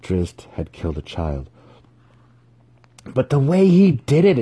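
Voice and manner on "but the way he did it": high, loud exclamation; eager, raised exclamation; exclaiming. A narrator's reading. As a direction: burst out in excited awe. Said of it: "exclaimed Dinan".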